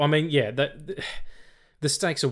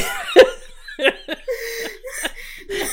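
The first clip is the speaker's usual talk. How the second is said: high burst of laughter; 3 dB louder than usual. Real, not acted. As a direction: crack up. Cracking up.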